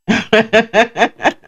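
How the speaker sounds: giggling through the word; can't stop laughing. Real, not acted. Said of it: "can't stop laughing now".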